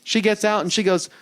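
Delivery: ordinary talk, nothing marked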